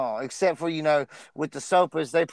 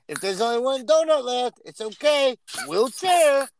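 higher-pitched imitated Mexican accent; 7 semitones higher, 3 dB louder than usual. Repeating the TikTok joke for confused friends, Mexican accent. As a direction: imitate a Mexican accent, high, leaning into the last word. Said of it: "he said in his, in his accent".

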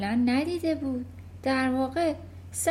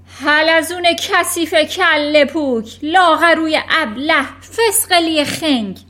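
loud, higher furious insults, slow; roar; 6 semitones higher, 11 dB louder than usual. Acted. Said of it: "نعره کشید".

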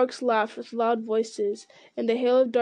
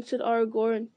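ordinary delivery

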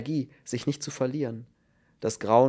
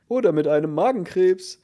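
much higher and louder, pinched tenor neighing out the words; whinnying squeezed tenor; 9 semitones higher, 8 dB louder than usual. A narrator's reading. Cellmate jeering at the chicken man, high squeezed whinny. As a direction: jeer like a braying horse. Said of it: "wieherte ein hoher Quetschtenor".

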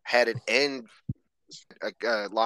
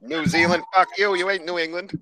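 mock angry outburst; 8 semitones higher, 6 dB louder than usual. Mocking Justin's accent snobbery.

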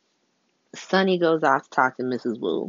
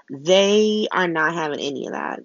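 ordinary delivery

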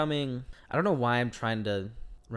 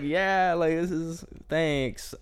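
lightly acted awkward thanks; 7 semitones higher, much slower than usual.

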